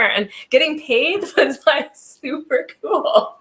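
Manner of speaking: giggling through the words, drawn out slowly; amused laugh while telling the story. Real, not acted. Laughing about getting paid.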